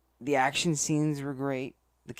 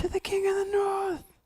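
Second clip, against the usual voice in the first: shouting; 7 dB louder than usual. Acted. Toast shouted to open show.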